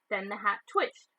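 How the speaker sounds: usual speaking voice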